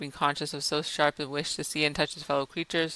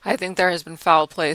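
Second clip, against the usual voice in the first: usual speaking voice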